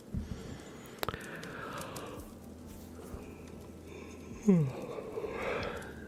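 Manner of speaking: long breathy yawn. Real, not acted. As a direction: let out a big yawn. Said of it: "I'm really yawny today".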